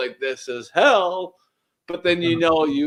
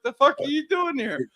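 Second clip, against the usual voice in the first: excited; 10 semitones higher than usual. Retold or imitated. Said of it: "the professor's like".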